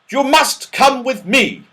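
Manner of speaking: high, loud military order; commanding. A narrator's reading. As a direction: order them like a strict captain. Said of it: "in a commanding voice".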